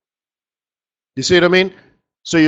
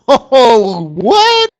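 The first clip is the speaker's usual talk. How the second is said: mock surprise; 7 semitones higher, 4 dB louder than usual. Mock surprise at favorite report.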